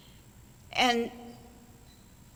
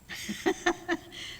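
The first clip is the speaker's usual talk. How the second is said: soft unvoiced chuckle; quiet airy laugh at the punchline to come; light breathy laugh; 11 semitones higher, 6 dB quieter than usual. Real, not acted. Laughs before the last group.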